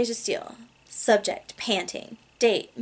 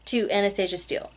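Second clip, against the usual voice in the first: usual speaking voice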